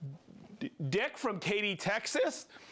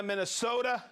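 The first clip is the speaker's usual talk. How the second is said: ordinary talk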